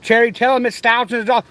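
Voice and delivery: usual speaking voice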